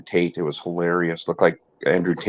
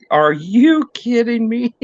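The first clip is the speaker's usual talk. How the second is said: incredulous; 4 dB louder, slower than usual. Retold or imitated. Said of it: "I was like".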